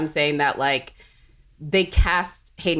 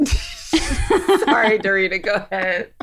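laughing; 2 semitones higher, slower than usual. Real, not acted. Laughing apology.

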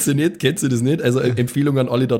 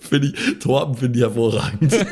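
words broken by laughter; laughing; 3 semitones higher than usual. Real, not acted. Player laughing, approving the rat's name. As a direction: laugh while praising the name.

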